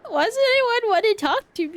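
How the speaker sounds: loud, high mock complaint; high-pitched whine. Player complaining about game characters, high-pitched whine. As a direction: whine it loudly, voice pitched right up.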